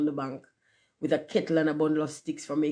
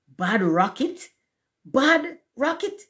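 slow, rising disbelief, the second far higher; incredulous echo; 9 semitones higher, slower than usual. A narrator's reading. Rocket thrown over the wall, incredulous. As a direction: repeat it as an affronted question, drawn out.

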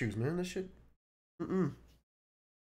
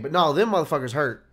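usual speaking voice

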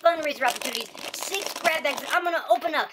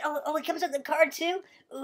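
usual speaking voice

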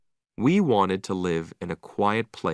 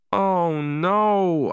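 high, drawn-out groan of dread; dismayed; 9 semitones higher, 4 dB louder than usual. A narrator's reading. Owner realising dog's accident is coming, dismayed. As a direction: let dread stretch the words, pitched up.

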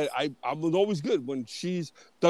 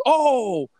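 mock friendly greeting; 6 semitones higher than usual. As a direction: play the caught agent acting all friendly. Agent caught, fakes friendly greeting.

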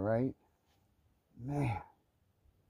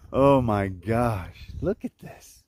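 ordinary talk, nothing marked

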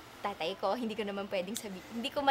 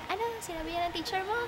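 imitating angry mother; 8 semitones higher, faster than usual. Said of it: "galit na galit si nanay".

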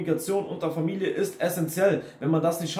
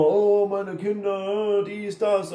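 slow, higher whiny parent voice; mock complaining parent; 5 semitones higher, much slower than usual. Vlogger mocking a toxic parent complaining to grandma, high and whiny. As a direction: drag out the parent's lament mockingly.

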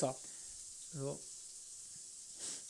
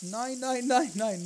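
panicked; 5 semitones higher, 3 dB louder, much slower than usual. Real, not acted. Cooking mishap.

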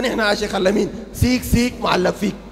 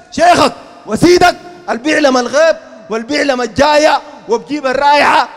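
scornful shout; 7 dB louder than usual. Acted. Preacher scorning sheikh's claims.

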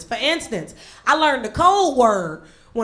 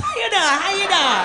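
high-pitched stiff-faced greeting; Scream mask face; 6 semitones higher than usual. Acted. Botox patient walking round greeting people, Scream mask voice. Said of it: "looking like the Scream Mask".

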